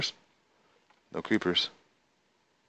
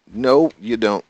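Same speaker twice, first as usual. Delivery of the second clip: scolding call; 5 semitones higher, 10 dB louder, slower than usual. Real, not acted. Shooing cows off pasture.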